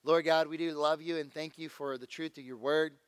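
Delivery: ordinary talk, nothing marked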